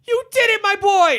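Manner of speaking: high, loud joyful cry; elated Wonka. Retold or imitated. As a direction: burst out with delight. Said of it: "he goes".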